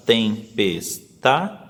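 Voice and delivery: Tempestade, syllables spaced out slowly while counting; teacher counting the syllables of Tempestade. Shown as a demonstration. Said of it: "Pode fazer contando".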